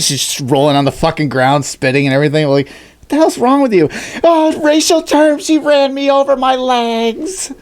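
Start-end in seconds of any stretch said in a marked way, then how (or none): 2.7-7.5 high, drawn-out hammed-up wailing; mock hysterical accusation. Karen on the ground accusing the driver, high slow mock wail. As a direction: whine it out high and slowly like a fake victim.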